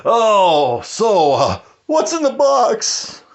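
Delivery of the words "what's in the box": much higher, slow anguished wail; hammed-up high cry of dread; desperate movie cry. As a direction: plead it like a man dreading the answer. Movie quote, growing despair.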